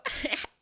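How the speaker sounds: short, high mock cackle; imitated cackle. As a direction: cackle briefly, mimicking someone's laugh. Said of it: "she just starts her cackle".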